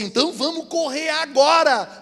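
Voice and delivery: high, slow hammed-up Jesus; a hint of Jesus voice; mock Jesus. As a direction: play Jesus lightly, stretched out. Jesus voiced in retold story.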